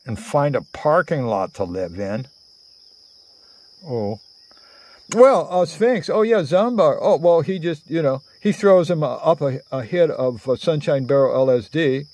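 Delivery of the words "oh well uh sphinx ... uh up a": usual speaking voice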